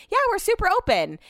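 high, bright-toned airy claim; high, careless promise to a friend; breezy overconfident yes. Retold or imitated. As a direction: toss it off sky-high, carefree. Retelling her breezy answer.

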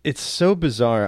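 ordinary talk

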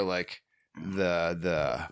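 usual speaking voice

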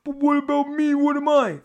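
high, loud mock wail; Danny DeVito. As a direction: whine it out loud in a hammed-up squeal. Acting out movie's Danny DeVito.

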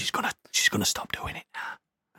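hushed unvoiced whisper. Real, not acted. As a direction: whisper so no one hears. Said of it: "She won't hear us whispering".

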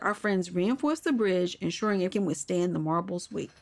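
ordinary delivery

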